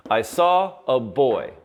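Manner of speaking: put-on "saw" and "boy" hit hard, "I" and "a" light; slow iambic beat landing on "saw" and "boy"; stress on SAW, BOY. Shown as a demonstration. Teacher scans a line for class, slow stress on SAW and BOY. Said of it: "boy are stressed".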